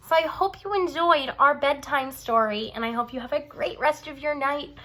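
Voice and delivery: usual speaking voice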